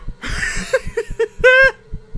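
high panicked cackle; hysterical laugh. Real, not acted. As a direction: laugh in frantic fear. Hysterical laugh during chase.

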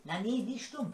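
ordinary talk

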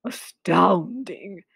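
slow, low monster amazement; Gruffalo. Acted. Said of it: "said the Gruffalo".

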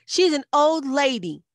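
high, loud indignant protest; yelling. A narrator's reading. Liz yelling at the egg thrower, high indignant yell. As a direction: yell in someone's defence. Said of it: "Liz yelled".

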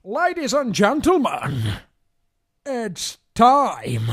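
slow, loud dramatic announcer; X Factor voiceover. Acted. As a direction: build it like a TV show intro. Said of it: "X Factor voiceover man".

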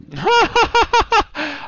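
loud, high, real laugh; high burst of laughter. Real, not acted. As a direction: break into startled laughter. Laughs after getting hit.

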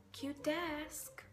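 a nervous chirpy "Cute desk"; awkward compliment. Retold or imitated. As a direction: blurt it out brightly.

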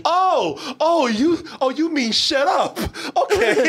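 high, suddenly polite British backpedal; mock British. Slapped son giving in to father, mock British. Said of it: "You turned British".

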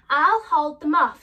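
loud, bold promise; brave declaration. Acted. Lion bravely tells friends he'll hold them off, loud. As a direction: stand firm and declare it.

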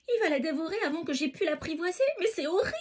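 high, rushed exclamation of alarm; horrified cry. A narrator's reading. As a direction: blurt it out fast and high, aghast. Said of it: "s'écrie le petit chaperon rouge".

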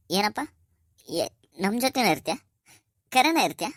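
high, rising astonished questions; hopeful disbelief. Acted. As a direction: ask as if scarcely daring to believe it. Father hears son will stay. (ಆಶ್ಚರ್ಯದ, ನಂಬಲಾಗದ ಏರುದನಿ)

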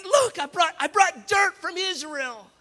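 very high, overexcited husband voice; excited husband. Retold or imitated. Husband presenting a bag of dirt to his wife, wildly excited. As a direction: exclaim high, drawing out the last words. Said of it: "he's so excited".

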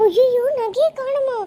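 high, loud alarmed gasp of disbelief; shocked cry. Acted.

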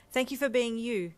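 hurried, relieved answer; quick reply. A narrator's reading. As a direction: answer promptly and emphatically. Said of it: "you replied quickly".

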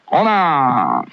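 slow stretched コーナー; drawn-out call. Real, not acted. Host announcing the segment, slow drawn-out call. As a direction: draw コーナー out lazily. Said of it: "コーナー行きましょうか".

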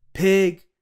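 loud, high one-word yell; explosive storybook holler; angry shout. A narrator's reading. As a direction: bellow it as an accusation. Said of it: "shouted Eugenia".

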